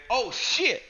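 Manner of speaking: high, thin "Oh, shit"; squeaky mock Chatterbox. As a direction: chirp it like a small excited creature. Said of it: "Chatterbox again".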